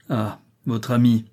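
plain reading voice

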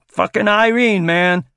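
gleeful shout; excited. Acted. Seal's excited outburst.

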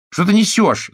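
high shrieking outburst; Chiara shouting. A narrator's reading. Chiara yells at Giuseppe, high and loud. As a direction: shriek it as if bitten by a snake. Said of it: "закричала Кьяра".

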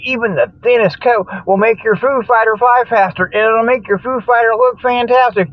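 usual speaking voice

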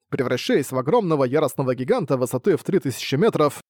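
usual speaking voice

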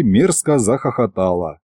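usual speaking voice

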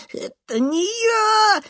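very high, stretched squeal; squealing denial. A narrator's reading. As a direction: squeal your innocence. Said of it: "визжит он". (визгливо отпирается)

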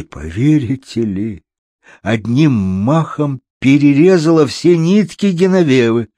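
plain reading voice

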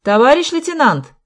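high, loud call. A narrator's reading. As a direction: shout to the next room. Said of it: "крикнул он в соседнюю комнату". (окрик в соседнюю комнату)

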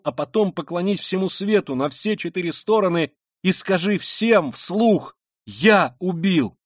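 high, urgent, insistent command; fervent Sonya. Acted. Sonya urging the killer to confess publicly, fervently.

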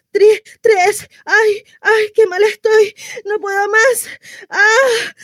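high, tearful moaning; acted labour pain. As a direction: count through a contraction and give up wailing. Breathing exercise fails in labour. (dolor de parto, quejido agudo)

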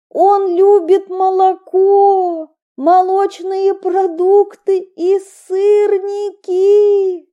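high, slow, crying shout; shouting across the square. Acted. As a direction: scream it to the whole square. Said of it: "Я кричу на всю площадь".

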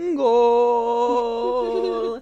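een luide, langgerekte doelpuntkreet van een Spaanse voetbalcommentator; soccer commentator goal call. Retold or imitated. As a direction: roep het als een Spaanse voetbalverslaggever. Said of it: "where they go like".

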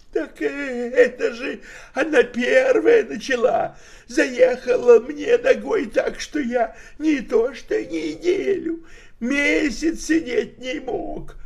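put-on high, loud, sheepish old tramp; embarrassed old man. A narrator's reading. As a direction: excuse yourself like a giggly old drunk. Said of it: "смущённо хихикнул старикашка".

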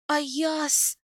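high, strained plea, mildly hoarse; choked rasp. A narrator's reading. Wife held by the neck calling her husband, choked. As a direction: gasp the name as if held by the throat. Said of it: "прохрипела я".

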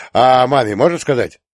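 put-on loud, eager boyish question; excited request. A narrator's reading. Vasyonok excitedly asks permission. (взволнованно, громко просит разрешения)

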